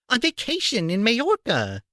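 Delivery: high, curt aunt voice, snapping; snapped. A narrator's reading. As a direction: bite off the words like an irritated aunt. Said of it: "snapped Aunt Petunia".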